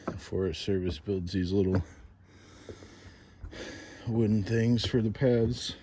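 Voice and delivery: breathing heavy from the climb; winded, talking between breaths. Real, not acted. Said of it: "Sorry, I'm breathing heavy".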